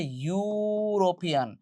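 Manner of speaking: ইউরোপিয়ান with a long, slow "yuu" opening; pronunciation demo. Shown as a demonstration. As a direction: draw out the first "you" sound of ইউরোপিয়ান. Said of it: "উচ্চারণ হচ্ছে". (ইউরোপিয়ান-এর "ইউ" লম্বা টেনে উচ্চারণ)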